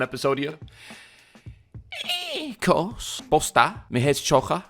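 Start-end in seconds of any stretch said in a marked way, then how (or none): 2.3-4.6 a jokey put-on Scouse accent, lightly done; playing at being a Liverpool local in Scouse; mock Scouse accent. Joking to Sway in a hammed-up Scouse accent. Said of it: "you think you can do a Scouse accent".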